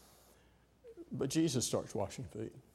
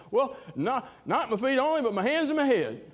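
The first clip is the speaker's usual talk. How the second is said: eager outburst; 11 semitones higher, 6 dB louder than usual. Acted. Peter's eager overreach.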